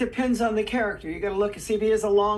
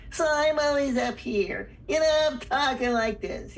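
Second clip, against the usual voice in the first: high-pitched voice; 5 semitones higher than usual. Shown as a demonstration. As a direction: place it way up high. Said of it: "I'm a high-pitched voice".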